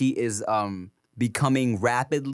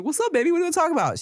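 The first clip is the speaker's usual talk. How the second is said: put-on much higher, gentle coaxing to a child; tender dad; 13 semitones higher, much faster than usual. Father gently asking his little daughter what's wrong. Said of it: "I'm like".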